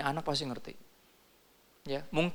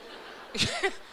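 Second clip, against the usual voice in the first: a quick high airy laugh at the joke; breathy high laugh. Real, not acted. Pastor laughing at the social drinker excuse, airy high laugh.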